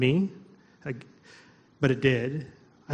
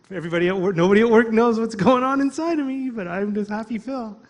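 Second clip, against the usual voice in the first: forced cheer; 7 semitones higher, 5 dB louder than usual. Retold or imitated. Acting out his forced cheerfulness.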